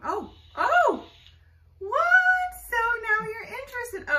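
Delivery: high squeal of surprise at the bird; suddenly thrilled, voice jumping up high; surprised delight. Real, not acted. Bird suddenly takes to toy.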